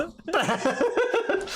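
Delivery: squealing laugh; high laugh. Real, not acted. After impression.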